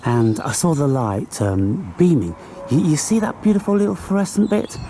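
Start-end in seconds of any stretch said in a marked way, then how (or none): 2.7-4.8 high-pitched marvelling; showing the glowing spot to viewers; delighted wonder. Real, not acted. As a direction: point it out in awe. Marvelling at light.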